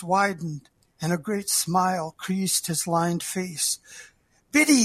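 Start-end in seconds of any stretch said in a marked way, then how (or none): 4.5-4.8 choked. Acted. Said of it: "he choked".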